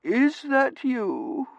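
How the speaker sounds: high, slow, swooning question; theatrically faint wife; weak invalid wife. A narrator's reading. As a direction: draw it out feebly in a high pitch. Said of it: "she said weakly".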